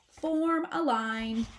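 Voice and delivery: mother possum commanding her babies. A narrator's reading. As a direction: give the order like a drill leader.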